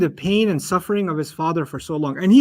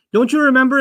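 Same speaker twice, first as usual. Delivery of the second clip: "Don't you remember?" higher and louder, gently prodding; reminding question; 5 semitones higher, 4 dB louder, faster than usual. Shown as a demonstration. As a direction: nudge their memory. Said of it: "is said when someone forgets".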